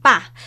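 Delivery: loud PA falling sharply; fourth tone. Shown as a demonstration. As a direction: Mandarin falling-tone PA. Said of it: "con los tonos".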